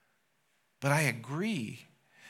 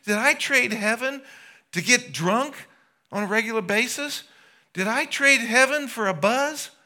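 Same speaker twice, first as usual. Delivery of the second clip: much higher and louder, strained and pleading; anguished cry through gritted teeth; 12 semitones higher, 8 dB louder than usual. Pretend. Preacher voicing the damned in hell: loud gritted-teeth wail. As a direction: wail each question in despair, teeth clenched. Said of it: "And grit your teeth and say".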